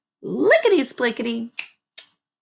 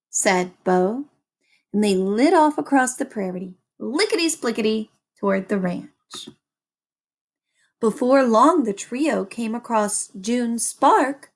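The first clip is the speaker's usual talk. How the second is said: plain reading voice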